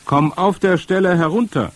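high, loud scolding call; calling up an order. A narrator's reading. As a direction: order the child down from afar. Grandmother calls up, ordering him down.

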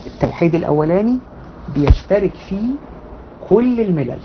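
hushed repetition. Real, not acted. Teacher repeating the key point to her class, hushed.

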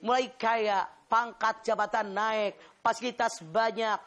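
preacher praising wives at full force; fervent preaching. Acted. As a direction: drive each line home to the congregation. Preacher exhorts the congregation.

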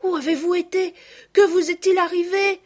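put-on excited little girls. The cousins pepper the returned girls with questions, high and excited. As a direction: burst out with questions, overjoyed.

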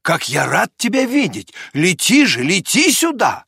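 a hint of an excited shout; joyful cry. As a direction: call him over with delight. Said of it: "вскричал доктор".

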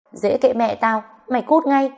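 high-pitched, defiant dismissal; angry retort. A narrator's reading. Woman telling her jealous ex to get lost, angry retort. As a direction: throw it back at him, pitch climbing. She angrily sends him away.

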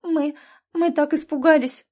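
high, loud, faltering start; tearful. A narrator's reading. Frightened nanny to the rescuers, tearful. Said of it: "всхлипнула его няня".

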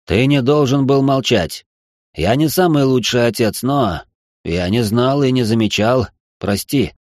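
low, slow apologetic father; deep sincere apology; remorseful low nobleman. Acted. Marquis apologizes.

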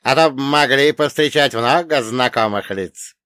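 loud gruff villain; Chernomor voice. Acted. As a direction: joke like an old rogue.

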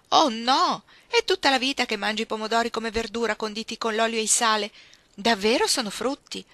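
shocked by the news. A narrator's reading. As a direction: react aghast to startling news. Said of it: "parve molto colpita dalla notizia".

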